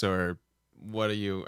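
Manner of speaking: ordinary talk, nothing marked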